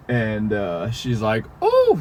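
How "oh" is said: sudden high yelp of a startled cleaner; imitating a startled shriek; mock yell. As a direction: squeal it out, high and loud. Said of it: "yells or something".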